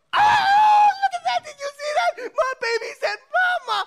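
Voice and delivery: very high, excited shriek; ecstatic mother. Acted. Mother showing off baby's first word, ecstatic high squeal. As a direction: squeal like a thrilled new mom. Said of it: "we get all excited".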